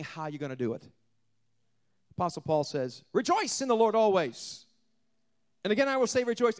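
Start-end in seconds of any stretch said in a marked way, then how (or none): 3.1-6.6 loud, raised preacher's proclamation; preaching rejoice. Acted. Preacher proclaiming Paul's command to the church.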